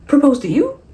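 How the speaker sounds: high, loud incredulous yelps, peaking on Marriage; mocking outraged disbelief. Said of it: "And he's like".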